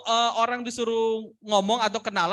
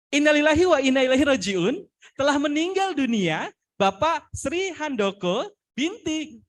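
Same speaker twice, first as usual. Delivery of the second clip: sad news read with an upbeat, higher lift; smiling delivery; 5 semitones higher than usual. Shown as a demonstration. Trainer shows students sad news wrongly delivered with a smile, smiling delivery. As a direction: announce a death as if smiling. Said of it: "menyampaikan dengan senyum".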